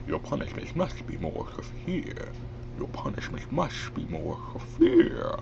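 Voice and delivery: soft, whispery villain with a lisp; Bane. Shown as a demonstration. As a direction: lisp a villain's threat out of the side of the mouth. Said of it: "out of the side of your mouth".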